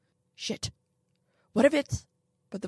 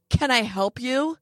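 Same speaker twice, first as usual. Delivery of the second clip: haughty; 6 semitones higher, 6 dB louder than usual. A narrator's reading. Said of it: "all haughty".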